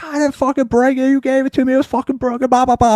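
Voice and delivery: high-pitched mock protest; imitated protesting. Imitating the attendant arguing back.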